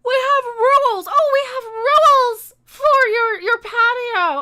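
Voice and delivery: high, loud whiny parody; exaggerated high-pitched mimicry of a complainer; mocking mimicry. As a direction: parrot a busybody's complaint mockingly. Mocking the woman's complaint about rules.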